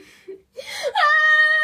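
high, loud wicked laugh; evil cackle. Pretend. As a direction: cackle like a hungry villain. Cat threatens to eat bird.

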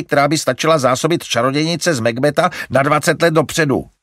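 Larry working himself up; fuming. A narrator's reading. Larry ranting at mother about animals, fuming. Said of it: "čílil se Larry".

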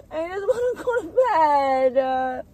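loud, high, slow sob; crying wail. Real, not acted. Crying meltdown over lost pillow.

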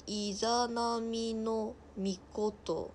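very slow, syllable-by-syllable reading; sounding out a name. Real, not acted. Streamer reading an unfamiliar god's name, slowly sounding it out. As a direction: stretch each syllable as you decipher it.

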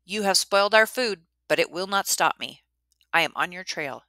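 calling out loud and firm; a brave boy's loud challenge to the monster. Acted. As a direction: shout it defiantly into the dark. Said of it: "said in a loud voice".

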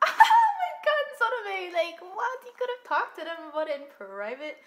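high, loud, stretched outcry; shocked shout. Real, not acted. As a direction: shriek at what just happened. Shocked outburst at scene.

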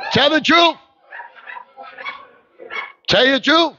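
urging the crowd to tell the truth; preaching call. Real, not acted. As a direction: call it out to the church.

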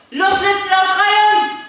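high, loud go call, last word stretched; urging shout. Real, not acted. Coach sending children running and screaming, shouted. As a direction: spur the runners on at full voice.

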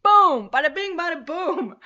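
loud, high, gleeful exclamation; triumphant shout. Real, not acted. Webcam back, celebrating loudly.

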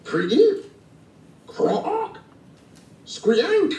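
high-pitched imitated frog noises, each rising; high frog-call imitations. A narrator's reading. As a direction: squeak and croak like a frog. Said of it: "Stink listened to frog calls".

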